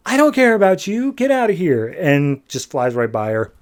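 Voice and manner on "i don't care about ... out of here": high-pitched, indignant brush-off; mock angry dismissal. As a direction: act out sending someone packing. Larfleeze angrily rejects Ardora.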